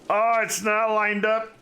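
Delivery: sudden high, loud dismay; surprised. Real, not acted. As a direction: blurt it out, shocked. Sees road misaligned, exclaims.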